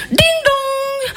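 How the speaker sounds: high, sustained sung ding-dong; imitated doorbell. Doorbell ringing in the porn-film sketch, high imitated chime.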